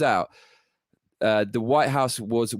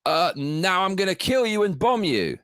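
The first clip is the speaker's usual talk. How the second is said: mock tough-guy threat; 6 semitones higher than usual. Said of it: "then you say".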